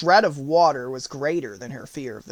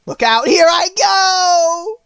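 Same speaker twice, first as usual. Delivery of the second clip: high, drawn-out final shriek; long melting-witch wail; dying witch's scream; 14 semitones higher, 11 dB louder, slower than usual. A narrator's reading. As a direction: scream it out, stretching the last word. Witch's last cry while melting.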